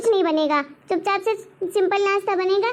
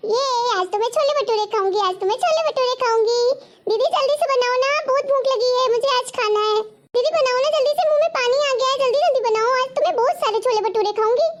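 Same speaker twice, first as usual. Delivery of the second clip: eager, higher-pitched begging; excited kid sister; 4 semitones higher than usual. Acted. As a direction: bubble over with hungry excitement. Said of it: "मैं इतनी excited हो रही हूँ".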